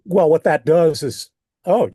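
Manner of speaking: normal talking voice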